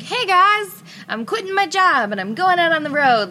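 excited announcement. Retold or imitated. Announcing quitting to everyone.

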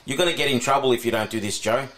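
usual speaking voice

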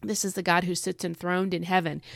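normal talking voice

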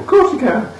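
high, sing-song mock cheerfulness; chirpy and cheerful. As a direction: imitate an overly bright, eager teacher. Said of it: "She's all, like, chirpy and cheerful".

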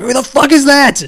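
loud, high, furious outburst at a stranger; retold outburst. Fan raging at an unknown sub, loud and high. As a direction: yell it in outraged disbelief. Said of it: "I was like / apoplectic with rage".

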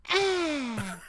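high-pitched mock moan; turtle mating moan. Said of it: "his mouth opens and he goes".

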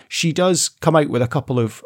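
usual speaking voice

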